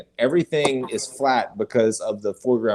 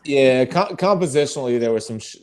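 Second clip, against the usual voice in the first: usual speaking voice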